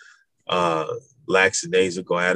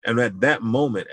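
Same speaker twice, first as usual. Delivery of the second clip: ordinary talk, nothing marked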